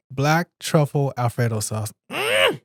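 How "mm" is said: delighted mm. Real, not acted. Tasting the truffle sauce.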